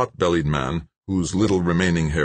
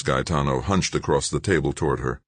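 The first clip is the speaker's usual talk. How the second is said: ordinary delivery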